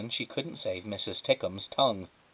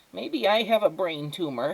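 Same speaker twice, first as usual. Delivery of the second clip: a bit higher, mild worry; lightly voiced fretful neighbour; 8 semitones higher than usual. Neighbour worries aloud.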